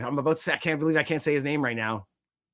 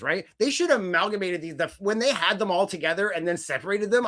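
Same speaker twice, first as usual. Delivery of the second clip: normal talking voice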